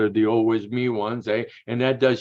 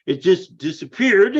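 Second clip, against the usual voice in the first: surprised; 7 semitones higher, 8 dB louder, slower than usual. Retold or imitated. Surprise at suppository vanishing.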